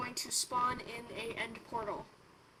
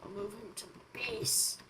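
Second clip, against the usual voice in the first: whispering; 4 semitones lower, 8 dB quieter than usual. Pretend. Scheming whisper before evil laugh.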